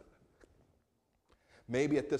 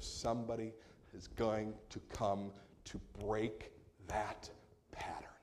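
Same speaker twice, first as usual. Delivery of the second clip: hushed angel's promise; 2 semitones lower, 7 dB quieter than usual. Acted.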